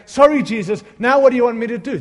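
loud, imitated guilty cry to Jesus; guilty plea. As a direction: beg like a guilty churchgoer. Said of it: "out there going".

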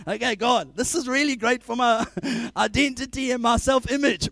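mock sarcastic prayer. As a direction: pray sarcastically to God.

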